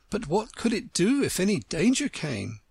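put-on wistful little girl; young girl voice. Alice voiced.

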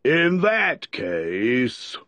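ponderous, stately character voice; solemn. A narrator's reading. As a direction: draw the words out with great gravity. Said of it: "said the dodo solemnly".

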